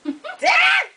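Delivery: high-pitched yelp of delight; high shriek. Real, not acted. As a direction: let out a short, high squeal. Excited reaction to new toy.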